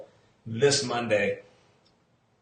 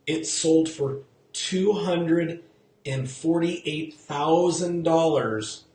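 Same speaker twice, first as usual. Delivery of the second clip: slow, weighty figure; 2 semitones higher, much slower than usual. Shown as a demonstration. Agent stressing the sale loss to viewers, slow and weighty. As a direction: dwell on each word of "two hundred and forty-eight thousand dollars". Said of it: "tell me if you hear anything".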